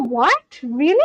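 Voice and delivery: ordinary talk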